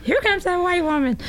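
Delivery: high-pitched mimicked warning; mock alarm call. Street dealers warn each other she is coming, mock alarm. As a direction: imitate a lookout's high call.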